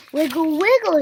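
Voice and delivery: high, loud command; shouted. A narrator's reading. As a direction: call out the wiggle move. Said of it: "shouted Piggy".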